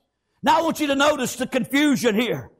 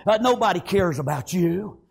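low, subdued devil's taunt; 7 semitones lower than usual. Acted. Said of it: "the devil rises up, he say".

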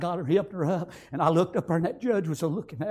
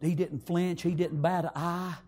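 ordinary talk, nothing marked

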